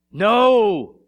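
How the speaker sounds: loud, high, drawn-out No; Peter's imitated refusal, at full volume; emphatic refusal. Peter refuses to let his Lord wash him, emphatic refusal. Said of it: "this is emphasized".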